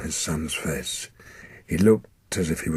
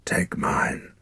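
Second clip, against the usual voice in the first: dying whisper; 3 semitones lower than usual. Acted.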